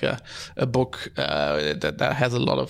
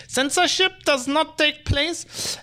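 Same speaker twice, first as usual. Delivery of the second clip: slow, high mock German accent; 14 semitones higher, much slower than usual. Shown as a demonstration. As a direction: say it like a stern German official. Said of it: "Probably have to say that in, in a German accent".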